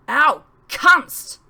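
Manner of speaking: sharp cry of pain, loud; loud pained outburst; pained yell. Acted. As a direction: yelp as she's elbowed. Assailant hit in windpipe.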